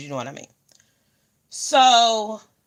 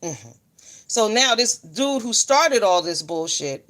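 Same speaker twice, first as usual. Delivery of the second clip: ordinary talk, nothing marked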